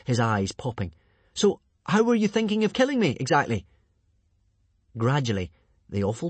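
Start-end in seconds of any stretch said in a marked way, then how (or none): 1.3-3.7 high, urgent stalling; strained question. A narrator's reading. Hiccup stalling the strangulator, high strained question. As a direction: ask politely under pressure.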